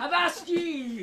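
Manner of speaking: pirate call. Acted. Pirate banter.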